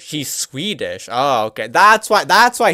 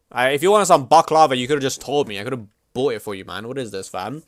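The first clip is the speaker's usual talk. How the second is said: ordinary delivery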